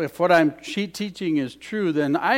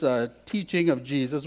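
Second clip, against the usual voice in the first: normal talking voice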